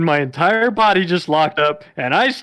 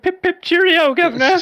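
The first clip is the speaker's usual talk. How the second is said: posh British put-on; 14 semitones higher than usual. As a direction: ham up a posh British accent. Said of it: "And go back to dry".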